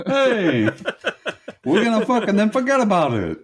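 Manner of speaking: put-on high-pitched New York accent; hammed-up New Yorker. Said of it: "heavy New York accent".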